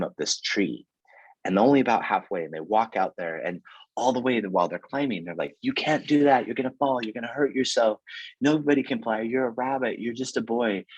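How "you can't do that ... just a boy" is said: high, nagging naysayer voice; mock the doubters, whiny and scolding; doubting animals. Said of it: "just throwing all of these doubts and fears at him".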